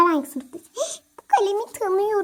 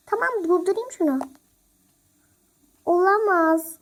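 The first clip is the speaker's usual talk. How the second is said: ordinary talk, nothing marked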